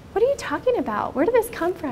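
high, incredulous; husband's amazed question. Retold or imitated. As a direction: ask it in high disbelief. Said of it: "He's like".

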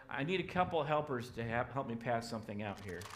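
ordinary talk

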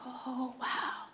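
breathless whisper of shock; whispered wow. Retold or imitated. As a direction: hush it, stunned. Reacting to friend's confession.

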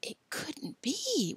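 slow, disbelieving whisper; hushed and drawn out. A narrator's reading. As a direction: whisper it slowly in doubt. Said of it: "whispered Tom".

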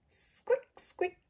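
mouse squeak. Retold or imitated. Reading to children, a soft high mouse squeak. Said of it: "Animal sounds".

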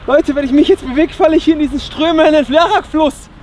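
ordinary talk, nothing marked

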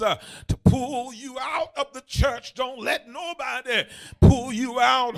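loud, fervent pulpit exhortation; full-force preaching. Real, not acted. Preacher exhorting the hurting to hold tight, full force. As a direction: drive each phrase home to the congregation.

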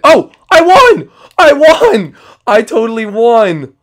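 high, loud victory shout, drawn out; triumphant cheer. Acted. After winning bumper boats, shouting with slow triumphant joy. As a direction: crow over the win, slowly and loudly. Wins the bumper boat game.

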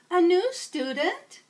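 put-on old teacher welcoming a new student; kindly teacher. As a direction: greet a new pupil warmly. Teacher greets newcomer.

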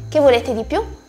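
ordinary talk, nothing marked

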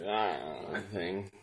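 wobbly mock drawn-out "ah"; imitating TV sitcom guy. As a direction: mimic that one guy with a wavering noise. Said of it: "he always talks like".

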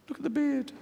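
high, giggly insistence; panicky inner voice. Retold or imitated. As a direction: point it out up high, half laughing. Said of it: "this one goes".

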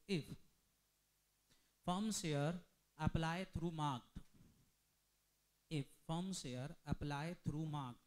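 slow, drawn-out classroom dictation. Real, not acted. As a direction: dictate word by word for note-takers. Teacher dictates case for writing.

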